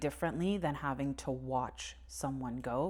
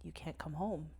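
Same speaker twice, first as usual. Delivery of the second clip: much softer, hushed bad news; gentle quiet telling; 8 dB quieter than usual. Telling dying grandfather he cannot come home, hushed and gentle. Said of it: "having to tell a man".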